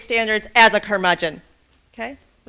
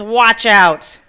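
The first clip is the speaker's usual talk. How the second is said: grumpy snap; 8 dB louder than usual. Acted. Old man snaps at boy.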